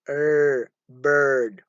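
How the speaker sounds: "bird" with the vowel coloured by r; careful teacher's "bird" with an r-coloured vowel; r-coloured schwa. Shown as a demonstration. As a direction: sound the er in "bird" firmly. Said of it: "it is followed by an er".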